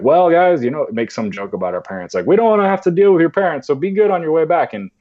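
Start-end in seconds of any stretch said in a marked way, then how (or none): 0.0-0.6 camp leader joking. Retold or imitated. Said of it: "the leader's like". 2.3-4.7 higher, louder, fast jokey counselor voice; camp leader joking. Retold or imitated. Camp leader teasing the kids before the bus home, quick jokey voice. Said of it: "the leader's like".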